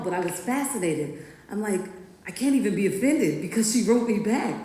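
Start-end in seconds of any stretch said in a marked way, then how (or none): 2.3-4.5 a bright, delighted inner voice, noticeably higher; gushing that she wrote back at all; thrilled. Retold or imitated. Retelling her delight at the teacher's letter, thrilled.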